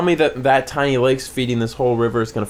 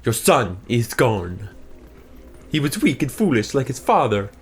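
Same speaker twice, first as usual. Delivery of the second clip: Kylo impression. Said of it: "That's how he talks".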